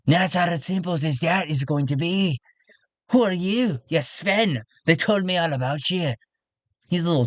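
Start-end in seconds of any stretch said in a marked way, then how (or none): 0.1-2.4 higher-pitched character voice, full of business; tactless councilman. Acted. Tradesman telling meddlers he has business to attend, tactless character voice. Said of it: "he's like". 3.1-6.1 higher-pitched, challenging character voice; tactless councilman. Acted. Councilman confronting a newcomer he has heard about, tactless character voice. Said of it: "he's able to talk like that".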